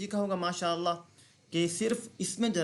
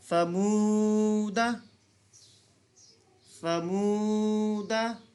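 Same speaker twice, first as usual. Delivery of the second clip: very slow, higher, long held chant; Quranic recitation; 4 semitones higher, 4 dB louder, much slower than usual. Shown as a demonstration. Teacher reciting the verse for students. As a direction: hold each vowel long in recitation. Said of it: "जी, आगे चलते हैं।".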